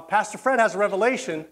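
ordinary talk, nothing marked

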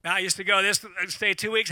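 normal talking voice

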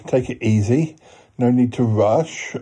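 usual speaking voice

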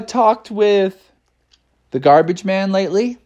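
ordinary delivery, nothing marked

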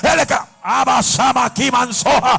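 loud ecstatic tongues; shouted glossolalia in the middle of preaching; speaking in tongues. Acted. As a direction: pour out tongues at full force. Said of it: "speaking in tongues mid-sermon".

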